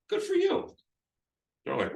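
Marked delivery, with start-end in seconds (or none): 0.1-0.7 very high-pitched, admiring praise; congratulating. Shown as a demonstration. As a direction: pitch it way up to applaud the seller. Acting the agent congratulating the seller.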